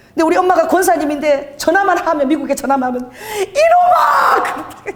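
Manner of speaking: high, tearful voice breaking into sobs; crying retelling. Retold or imitated. Gangster tearfully quoting his scolding mother. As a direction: tell it through tears and laughter. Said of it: "우시면서".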